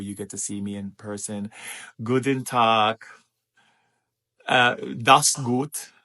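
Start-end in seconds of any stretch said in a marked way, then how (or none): 2.0-5.9 loud, punchy German greeting; greeting call. Real, not acted.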